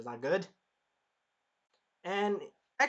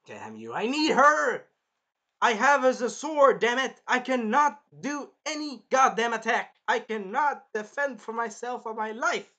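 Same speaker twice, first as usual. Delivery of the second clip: desperate rant; 7 semitones higher, 7 dB louder than usual. Acted. Naofumi raging that he cannot fight alone, loud desperation. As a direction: pour out frustration.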